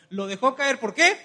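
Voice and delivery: loud rising call to the crowd; preacher's call-out question. Acted. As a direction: fire the question at the church. Preacher calls question to congregation.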